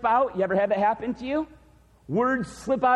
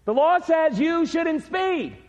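emphatic preaching; 7 semitones higher, 4 dB louder, slower than usual. Acted.

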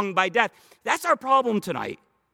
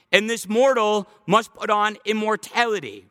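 usual speaking voice

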